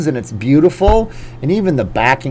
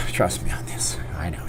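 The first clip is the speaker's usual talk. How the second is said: whispered aside; 7 semitones lower, 10 dB quieter than usual. Real, not acted. Telling viewers about his hockey-banning mother, in a whisper. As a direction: whisper it softer.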